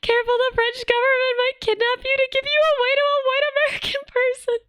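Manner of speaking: high put-on voice reading a chat message; silly chat voice. Reading a viewer's joke warning aloud, in a high silly put-on voice. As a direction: squeak the warning in a cartoonish voice.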